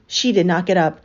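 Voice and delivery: ordinary delivery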